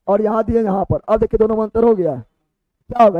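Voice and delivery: usual speaking voice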